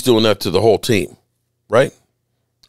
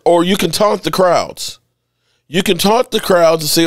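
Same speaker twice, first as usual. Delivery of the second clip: ordinary talk, nothing marked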